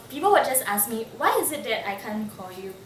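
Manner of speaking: ordinary delivery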